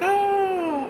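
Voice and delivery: high mock wailing cry; a hammed-up high wail like the crying guy; imitated wail. Mimicking the man crying in his car, high mock wail. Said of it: "crying and carrying on and screaming".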